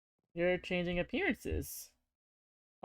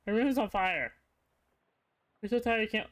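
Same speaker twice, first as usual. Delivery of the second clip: usual speaking voice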